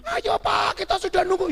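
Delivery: high, loud imitation of waiting women; shrieked mock summons; calling out. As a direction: holler for him to hurry up. Women calling the man to come.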